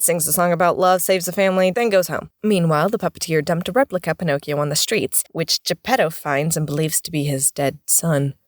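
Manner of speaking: usual speaking voice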